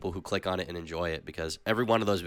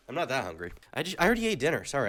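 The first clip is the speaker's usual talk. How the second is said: normal talking voice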